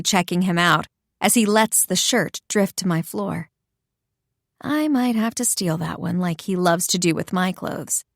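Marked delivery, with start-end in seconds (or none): none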